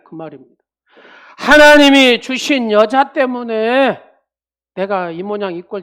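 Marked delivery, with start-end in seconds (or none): 1.4-4.0 slow, higher, loud aggrieved whine; complaining Adam. Retold or imitated. Adam whining to God about the woman, slow and loud. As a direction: drag out the complaint. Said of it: "이런 얘기입니다".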